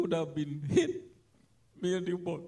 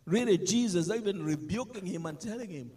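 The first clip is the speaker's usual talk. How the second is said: ordinary talk, nothing marked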